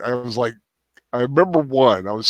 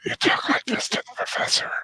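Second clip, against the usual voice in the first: airy, voiceless hush; breathy ASMR whisper; 5 semitones higher, 5 dB quieter than usual. Shown as a demonstration. Teasing about the sensitive professor, ASMR whisper. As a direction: whisper it intimately, all breath. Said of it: "You just talk really breathy and low".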